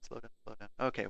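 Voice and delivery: ordinary talk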